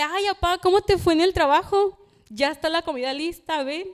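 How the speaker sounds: a lightly voiced affectionate daughter; sweet daughter greeting. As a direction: greet dad at the door lovingly. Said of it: "yo ir a abrirle la puerta y decirle".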